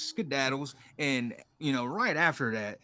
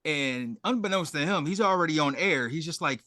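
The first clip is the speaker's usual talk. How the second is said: usual speaking voice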